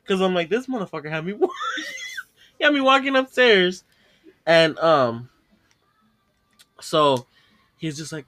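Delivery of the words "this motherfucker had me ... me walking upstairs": higher, breaking up with laughter; outraged but helpless with laughter; laughing indignation. Real, not acted. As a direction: complain while losing it laughing. Cracking up at own story.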